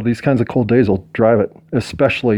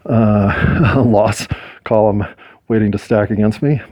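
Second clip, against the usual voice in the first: ordinary delivery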